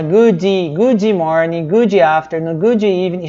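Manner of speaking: "good" said as "goody", with an ee vowel after the d; extra i after good. Shown as a demonstration. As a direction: add a wrong ee sound to the end of every "good". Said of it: "evita de colocar i aqui no meio". ("good" com um i no final, tipo "gúdi")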